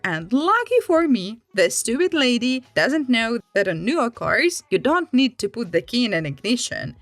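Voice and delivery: ordinary delivery, nothing marked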